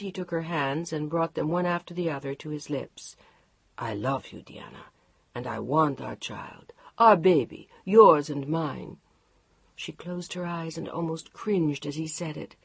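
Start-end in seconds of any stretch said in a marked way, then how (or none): 3.8-9.0 low, hushed, whispered love words; husband whispering tenderly. Acted. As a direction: whisper it to win her back. Husband tender after fight.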